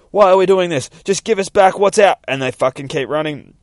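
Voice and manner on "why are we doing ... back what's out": raised, high demanding voice; exasperated calling. Acted. Girls chasing ninjas, loud exasperated demand. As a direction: demand it loudly on the run. Acted shouting during chase.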